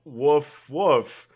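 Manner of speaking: a hint of a badly faked dog; dog woofing. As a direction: say the woofs like a dog trying to pass. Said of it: "the dog looks at her and goes".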